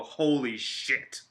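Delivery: slow, drawn-out mock amazement; awestruck reaction. Fan imagining Bryan Danielson showing up, slow awestruck reaction. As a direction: act out being floored, stretching each word slowly. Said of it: "would make me go".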